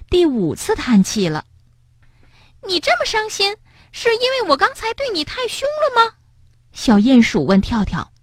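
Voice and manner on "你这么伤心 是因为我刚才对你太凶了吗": much higher, gentle mole voice; little mole. Acted. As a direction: play the small mole asking kindly. Mole character voice.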